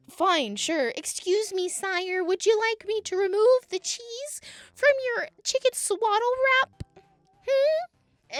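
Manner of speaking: very high, loud mock-polite voice; sarcastic servility. Sarcastic over-servile offer to customer.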